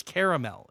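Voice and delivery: caramel said fully as KARE-a-mel, three syllables; caramel with the middle A sounded. Shown as a demonstration. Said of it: "I don't like when people pronounce caramel caramel".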